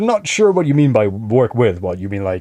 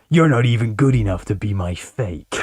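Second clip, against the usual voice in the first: usual speaking voice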